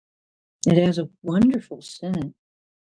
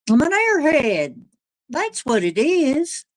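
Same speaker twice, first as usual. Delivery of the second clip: self-mocking; 10 semitones higher than usual. Real, not acted. Joking about herself.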